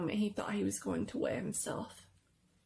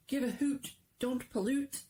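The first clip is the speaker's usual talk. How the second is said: cheery slogan; 6 semitones higher than usual. A narrator's reading. Reading slogan poster.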